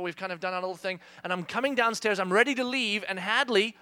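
normal talking voice

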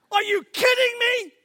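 high, loud incredulous outburst; teacher floored by a dumb question; exasperated disbelief. Retold or imitated. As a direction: throw up your hands in disbelief.

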